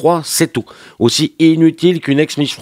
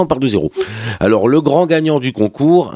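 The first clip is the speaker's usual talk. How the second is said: ordinary talk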